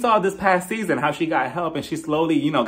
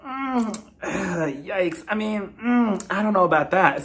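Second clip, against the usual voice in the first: mock-reluctant hemming, higher pitched; hesitant unease; 3 semitones higher, much slower than usual. Sutton stand-in wavering to a friend, mock hesitant. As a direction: hem and haw with a sigh. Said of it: "she's looking at Crystal like".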